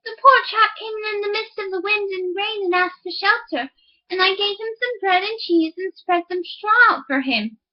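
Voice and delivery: a hint of a sly farm wife; miller's wife voice. Wife excuses herself.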